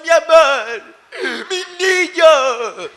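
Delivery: a hammed-up weepy parent coddling; doting parent. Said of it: "hay papás que son".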